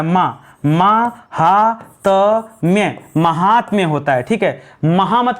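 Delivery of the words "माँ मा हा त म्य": मा हा त म्य broken into separate slow syllables. Shown as a demonstration. Teacher breaking महात्म्य into syllables for students. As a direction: spell the word out one syllable at a time, very slowly. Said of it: "महात्म्य नहीं, माँ होता है".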